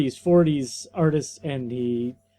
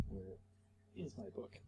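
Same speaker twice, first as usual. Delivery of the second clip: whispered aside; 8 semitones lower, 29 dB quieter than usual. Real, not acted. Searching for his book.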